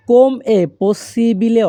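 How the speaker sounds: very slow, every syllable laid out clearly; slow teaching demo. Shown as a demonstration. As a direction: spell the phrase out slowly for learners. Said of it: "Italian ভাষা". (ধীরে ধীরে স্পষ্ট করে বলা)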